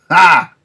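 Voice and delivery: one loud scornful ha; villain's loud sneering ha; mocking laugh. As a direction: bark a single derisive laugh.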